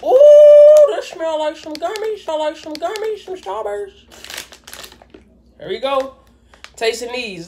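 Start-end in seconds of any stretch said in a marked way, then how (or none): 0.0-0.9 excited high ooh; high squeal. Real, not acted. Excited squeal at candy smell. 0.9-4.0 excited. Real, not acted. Sniffing candy, excited.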